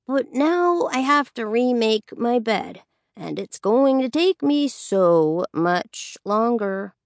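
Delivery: slow teasing complaint in a put-on voice; slow, provoking drawl. A narrator's reading. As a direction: drag it out to needle a sibling. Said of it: "he says slowly".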